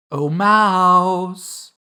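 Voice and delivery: high, loud, drawn-out MOUSE; calling out. A narrator's reading. Alice first addresses the mouse, calling out. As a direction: call "Oh, mouse" out grandly, high and long.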